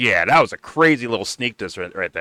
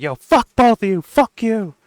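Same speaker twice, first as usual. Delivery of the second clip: pretend angry outburst; 11 semitones higher than usual. Nick exploding at the two hosts, yelling angrily. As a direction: blow up at both of them. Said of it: "is like".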